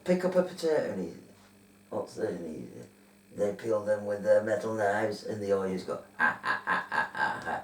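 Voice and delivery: put-on robot Martian cackling like the old advert; Smash Martian voice. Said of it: "are you gonna do your Martian impression".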